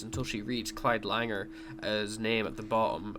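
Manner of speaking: ordinary talk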